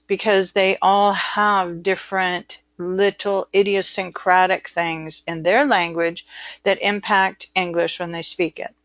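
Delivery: ordinary talk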